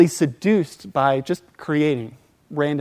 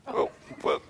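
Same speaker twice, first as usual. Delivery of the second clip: frightened whisper; 10 dB quieter than usual. Retold or imitated. Terrified reply to leader.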